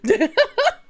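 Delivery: short high burst of laughter. Real, not acted. Laughs at self.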